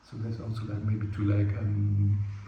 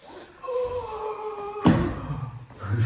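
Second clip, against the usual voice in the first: long high drawn-out "oooh"; slow-motion howl. Shown as a demonstration. Play fighter demonstrating a slowed fight, long high mock howl.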